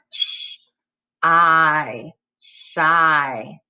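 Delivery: "Sh" and "I" held apart, then blended "shy"; "Sh... I... shy", each sound separate and drawn out. Shown as a demonstration. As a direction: segment "Sh", "I", then say "shy" whole. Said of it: "break it into its component parts".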